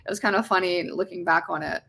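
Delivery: ordinary delivery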